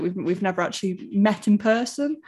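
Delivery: ordinary talk, nothing marked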